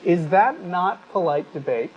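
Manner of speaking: ordinary delivery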